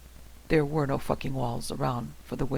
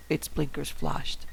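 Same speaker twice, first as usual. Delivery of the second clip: usual speaking voice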